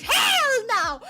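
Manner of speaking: high, loud falling cry; playful outraged shout; excited shout. Real, not acted. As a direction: yell it out in mock outrage. Answering her own question.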